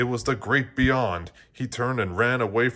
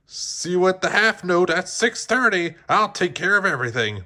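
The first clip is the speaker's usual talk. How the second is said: higher, louder breezy promise; Moonwind's voice; 7 semitones higher, 3 dB louder than usual. A narrator's reading. Moonwind promises Joe he'll fix everything, breezy. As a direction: reassure them breezily. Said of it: "Moonwind said".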